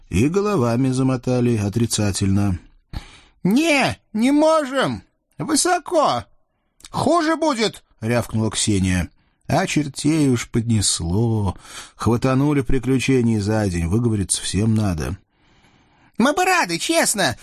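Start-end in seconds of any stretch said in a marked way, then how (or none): 3.4-7.8 very high squeaky devil voice, pleading; a scrawny devil begging off; whiny little devil. Acted. Тощий чёрт Наум причитает. 16.2-17.4 very high squeaky devil voice, pleading; a scrawny devil begging off; whiny little devil. Acted. Тощий чёрт Наум.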